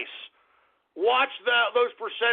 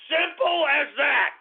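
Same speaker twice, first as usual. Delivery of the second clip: shouted; 7 semitones higher, slower than usual. Real, not acted. Host hammering his advice to listeners, shouted. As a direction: slam the point home with a yell. Yelled punchline after insult.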